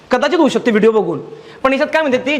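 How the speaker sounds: usual speaking voice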